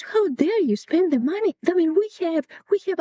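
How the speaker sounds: high, sharp scolding of a son, pitch way up; furious scolding. Retold or imitated. Grandmother scolding her son for buying peaches, furious high voice. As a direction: scold him for wasting the money. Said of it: "my grandmother freaked out".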